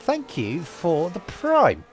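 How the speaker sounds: slow, drawn-out shoutout thanks; clumsy streamer announcer voice; awkward stream announcement. Acted. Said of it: "I'm terrible at announcing that stuff".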